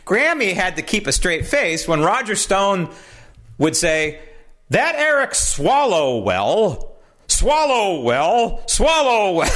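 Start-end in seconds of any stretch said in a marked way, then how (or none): 4.7-9.5 "Swallowwell" drawn out slowly, stretched into swallow-well; deliberate mispronunciation. Retold or imitated. Host imitating a guest mocking a congressman, saying "Swallowwell" slowly. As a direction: savour "Swallowwell" with a mocking slow drag. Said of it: "kept mispronouncing Swalwell's name on purpose".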